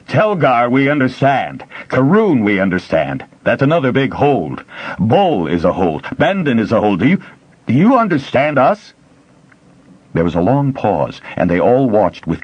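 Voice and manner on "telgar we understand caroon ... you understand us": raised voice. A narrator's reading. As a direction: speak up to be understood. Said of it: "He raised his voice unthinkingly".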